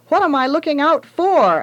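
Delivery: put-on boy lookout calling to his family down the lot. A narrator's reading. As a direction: call out to people far away. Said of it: "I called after a few minutes".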